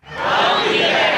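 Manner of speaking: very high, slow cultist echo; crowd echo. Shown as a demonstration. Crowd echoing the pledge line, very high and slow. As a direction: squeak it back like an eager follower. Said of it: "recite the Pledge of Evil Allegiance".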